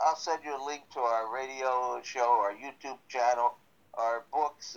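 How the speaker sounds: usual speaking voice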